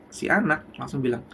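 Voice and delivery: ordinary talk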